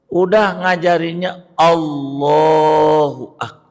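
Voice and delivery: normal talking voice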